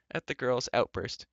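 ordinary delivery

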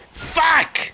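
high, sudden curse; real high-pitched cry of frustration; frustrated outburst. Real, not acted. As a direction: yelp the swear word in alarm. Mishap in game.

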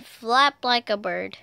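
plain reading voice

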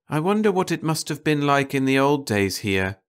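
ordinary delivery, nothing marked

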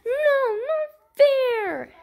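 high loud protest; whiny kid. Pretend. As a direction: whine about unfair timeout. Child refuses timeout.

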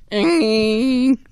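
a hint of a high put-on voice; Marvel character impression. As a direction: try a character voice for one word. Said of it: "He sounds kinda like this".

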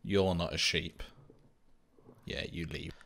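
ordinary talk, nothing marked

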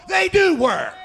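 preacher's shouted insistence; emphatic shout. Real, not acted. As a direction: hit it hard, preacher insisting. Insisting the bus ministry works.

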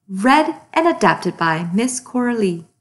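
ordinary delivery, nothing marked

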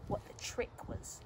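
usual speaking voice

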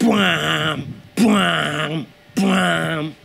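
three long imitated brass bwams; Inception horn blasts. Comedian mimics the Inception soundtrack, sustained horn noises. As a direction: imitate booming film-trailer horns, each held. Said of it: "I make the noises".

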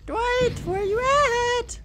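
taunting call, pitched high; high sing-song call. Pretend. Calling for Dwight.

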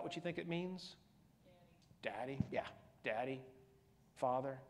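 usual speaking voice

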